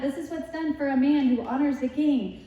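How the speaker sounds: a herald in front of the horse proclaiming; herald's cry. Retold or imitated. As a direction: call it out ahead of the parade. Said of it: "You should have someone going in front".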